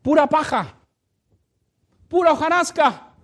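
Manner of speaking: loud, high preaching call; preaching at full force. Acted. Pastor preaching hard to the church. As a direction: preach it out to the congregation.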